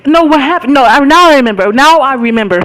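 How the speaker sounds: a loud surprised no, then now I remember; sudden recollection. Real, not acted. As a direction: blurt it out as it comes back to you. Suddenly recalls the real story.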